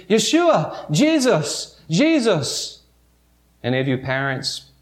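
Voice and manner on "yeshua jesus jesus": higher, urgent calls into a crowd; calling out. Acted. Parents searching Jerusalem for their son, calling out anxiously. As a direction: call his name searching anxiously. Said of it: "they're saying".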